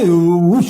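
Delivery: loud vacuum whoosh; loud flush whoosh. Retold or imitated. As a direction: blast out the toilet whoosh. Imitating vacuum flush sound. (ज़ोरदार flush की हुश आवाज़)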